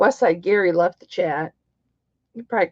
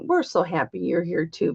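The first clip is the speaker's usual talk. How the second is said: ordinary talk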